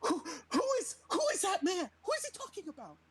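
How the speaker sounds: high, stammering mock panic; hammed-up frantic questioning; frightened. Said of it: "Like, I'm frightened".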